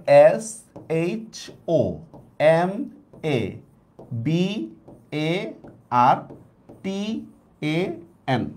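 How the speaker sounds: each letter of সমাবর্তন spelled out slowly; slow letter spelling. Shown as a demonstration. Teacher spelling a Bangla word in English letters, slowly. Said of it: "আংলিশ করি এটাকে".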